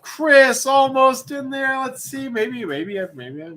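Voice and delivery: will the pick land there; excited. Real, not acted. Watching a random pick spin.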